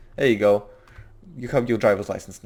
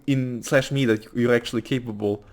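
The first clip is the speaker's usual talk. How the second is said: usual speaking voice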